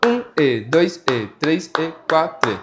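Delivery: "Um E, dois E" in an even rhythm, each "E" an offbeat; counting subdivided beats. Shown as a demonstration. Teacher demonstrating beat subdivision, "Um E, dois E" counted out rhythmically. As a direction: count "quatro E" like beat and half-beat, evenly spaced. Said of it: "a gente faz batendo palma mesmo".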